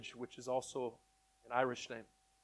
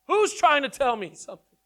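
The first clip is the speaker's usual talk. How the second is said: much higher, louder bewildered demand; confused outburst; 10 semitones higher, 11 dB louder than usual. Retold or imitated. Son on the phone baffled by his mother's words, loud bewildered cry. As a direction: shout out the question, lost.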